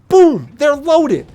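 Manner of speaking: normal talking voice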